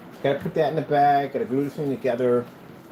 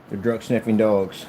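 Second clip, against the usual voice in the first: ordinary delivery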